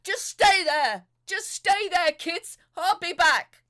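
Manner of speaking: loud, high shout from the water; father shouting from the water to his children. Acted. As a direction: yell it back to the kids while swimming. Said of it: "he shouted to us from the water".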